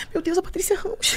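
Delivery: a shocked whisper; whispered gasp. Retold or imitated. Girls in the bathroom whispering in shock.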